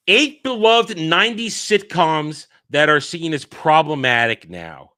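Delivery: slow, loud announcer read; headline read. Acted. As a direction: announce the title slowly. Reading headline aloud.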